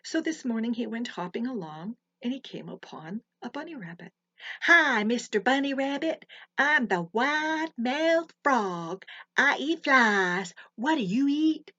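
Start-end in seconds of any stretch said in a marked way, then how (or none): 4.6-11.7 high, loud, slow wide-mouthed frog boast. A narrator's reading. Frog greeting the bunny in a high, loud, slow voice. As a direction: stretch the words wide like a big proud frog.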